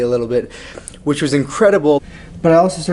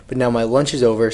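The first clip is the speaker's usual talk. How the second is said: normal talking voice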